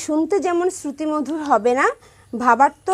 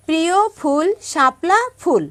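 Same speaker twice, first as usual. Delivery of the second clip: recited line; 5 semitones higher, 4 dB louder than usual. Shown as a demonstration. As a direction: clear recitation of the rhyme line. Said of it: "দেখো, এখানে".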